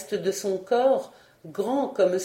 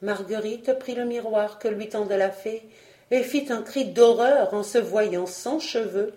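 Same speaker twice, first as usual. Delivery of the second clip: ordinary delivery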